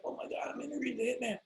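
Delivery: quick breathless starstruck gush. Pretend. Fan meeting his hero, fast and starstruck. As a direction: rush it like a dazzled fan. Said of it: "see, I'm starstruck".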